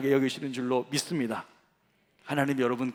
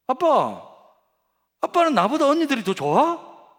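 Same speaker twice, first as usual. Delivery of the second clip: put-on high, loud pleading little girl; child voice; 11 semitones higher, 8 dB louder, slower than usual. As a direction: whine like the youngest. Youngest daughter asks. (높고 크게 조르는 막내딸 목소리)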